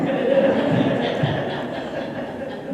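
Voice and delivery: long breathy laugh. Real, not acted. Speaker amused by the prom memory, laughing. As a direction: laugh it out after the story. Tells a fond funny memory.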